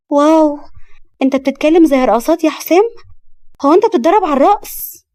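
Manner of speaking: high, loud mock amazement; teasing little sister. Little sister mocking her brother's dancer talk, high and gleeful. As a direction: tease the big brother with glee.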